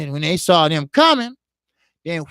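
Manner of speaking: normal talking voice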